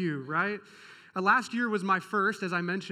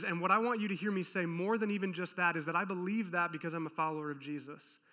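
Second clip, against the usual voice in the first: normal talking voice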